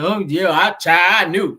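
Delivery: a loose, casual at-home gossip voice; LaToya's home voice. Retold or imitated. Mocking her relaxed way of talking at home. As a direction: drop the polish and talk like you do at home. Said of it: "she talk like".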